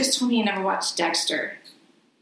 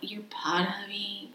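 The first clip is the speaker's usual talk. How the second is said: whispered warning; 9 dB quieter than usual. Acted.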